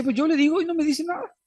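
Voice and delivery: high, put-on voice of a frustrated spouse; complaining person. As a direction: squeak it out as the hurt partner who gets no answer. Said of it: "Y después dice".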